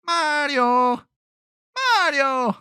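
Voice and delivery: very high cartoon calling out for Mario; young Luigi calling. Podcaster recalls young Luigi calling Mario, very high cartoon voice. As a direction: call for your brother, pitch way up. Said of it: "Remember what he sounded like?".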